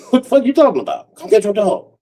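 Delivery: high-pitched retold quarrel; mock indignation. Arguing with his ex over the dog, high mock indignation.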